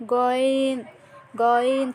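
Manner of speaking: গয়েন from the end of the throat, without pressure; Arabic letter demo. Shown as a demonstration. Teacher showing students how to say গয়েন. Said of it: "গয়েন সাধারণভাবে হলকের শেষ ভাগ হতে পড়তে হবে".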